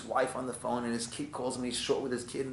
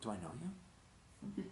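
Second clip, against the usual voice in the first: softer, puzzled questions; baffled reply; 6 semitones higher, 10 dB quieter, faster than usual. Acted. Stranger puzzled by the angry driver, baffled. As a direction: sound genuinely clueless about it. Other guy baffled by outburst.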